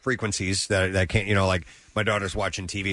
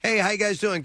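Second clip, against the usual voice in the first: cheerful greeting; 11 semitones higher than usual. Retold or imitated. Retold cheerful greeting to neighbors.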